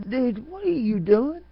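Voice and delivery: catching a vampire at his neck; high, slow disbelieving cry; shocked. Retold or imitated. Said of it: "I'm like".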